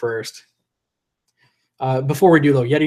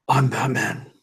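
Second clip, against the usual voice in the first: hoarse attempt at a masked hero's voice; Batman; 2 semitones lower, slower than usual. Shown as a demonstration. Streamer doing a requested catchphrase while losing his voice, rough hero impression. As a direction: put on the superhero voice with a worn-out throat. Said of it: "do my best I'm Batman".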